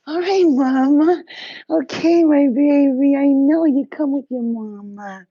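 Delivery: high sing-song pet voice, drawn out. Real, not acted. Cooing to her pet.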